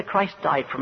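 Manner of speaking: usual speaking voice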